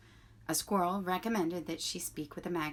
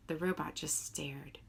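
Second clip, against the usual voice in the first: usual speaking voice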